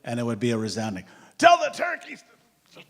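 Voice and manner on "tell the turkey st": high, loud, drawn-out crowd yell for the turkey story; audience yelling. Retold or imitated. The audience shouting at Stuart for the turkey story, a loud high yell. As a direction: bellow the request like a whole crowd.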